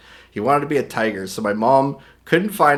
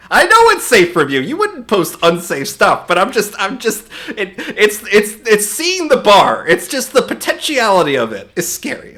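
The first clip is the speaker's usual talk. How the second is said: raised, louder voice, protesting in fits and starts; flustered defensiveness; 8 semitones higher, 7 dB louder than usual. Real, not acted. Streamer defending jitters over censored posts to viewer, flustered. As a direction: justify your nerves, higher and more insistent.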